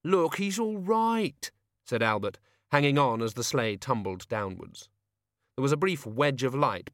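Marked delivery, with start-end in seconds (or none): 0.0-1.5 high, slow pleading; pleading old man. A narrator's reading. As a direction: whine to be let off. Albert pleading.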